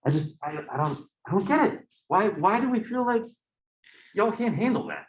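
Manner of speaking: high-pitched, incredulous complaint; exasperated, stumbling into a high plea. Real, not acted. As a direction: protest in baffled disbelief, pitched high. Mock exasperation at textbook writers.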